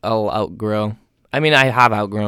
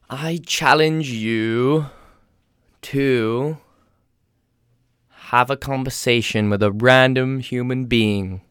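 slow, drawn-out proclamation, slightly raised pitch; mock-solemn challenge; 3 semitones higher, slower than usual. Leaving listeners with a challenge, mock solemn. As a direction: issue it like a grand challenge.

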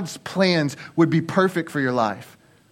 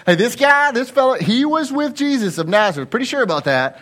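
put-on accusing servant girl; 9 semitones higher than usual. Servant girl pointing Peter out to the people, accusing. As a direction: call out a suspect to the crowd. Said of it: "another servant girl saw him".

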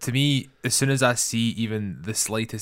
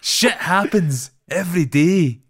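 high, slow, deadpan mock line reading; over-the-top flat melodrama, higher and louder; mocking wooden actor; 8 semitones higher, 8 dB louder, slower than usual. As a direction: parody a stiff table read, pitched up and drawn out. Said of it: "it felt like a table read".

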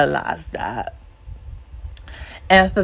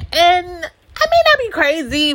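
jumps way higher, a sing-song tease; playful high voice; 12 semitones higher than usual. Real, not acted. Admitting to listeners she has her moments, playfully high. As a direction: tease yourself in a high lilting voice.

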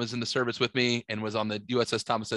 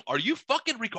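outraged challenge, pitched up; retold yell; 9 semitones higher than usual. Telling how he confronted his neighbor, retold yell. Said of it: "I start yelling at him".

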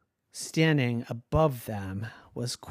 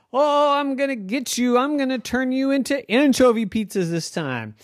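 mock villain threat; 10 semitones higher, 4 dB louder than usual. Queen Fluffy threatens.

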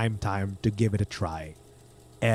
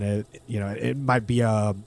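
usual speaking voice

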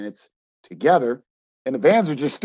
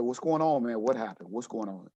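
soft questions, sinking lower and quieter; gentle concern; 3 semitones lower, 7 dB quieter than usual. Retold or imitated. Coach going up to his crying player, gentle concern. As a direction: ask softly, fading down.

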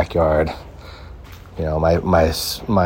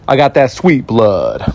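slow, higher joking brag; teasing sweet-blood boast; playful brag; 4 semitones higher, 4 dB louder, slower than usual. Real, not acted. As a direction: show off to the mosquitoes. Joking about mosquitoes.

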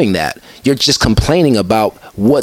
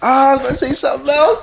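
higher, laughing through the words; 13 semitones higher than usual. Real, not acted. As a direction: laugh it off after forgetting. Laughing at forgetting his point.

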